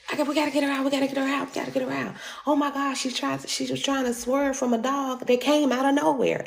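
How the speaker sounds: high, hurried crowd voice; panicked bystanders. Retold or imitated. Bystanders voiced at crash scene.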